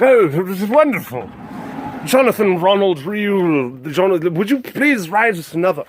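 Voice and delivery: hammed-up publisher gushing. Publishers begging Tolkien for another book, publisher voice. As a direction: gush like a put-on publisher. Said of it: "that's the publisher voices".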